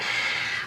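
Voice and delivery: long, soft breathy sigh; exasperated sigh. Retold or imitated. Fan seeing her name misspelled, exasperated sigh. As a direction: let out a long acted sigh.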